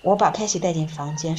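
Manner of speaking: ordinary delivery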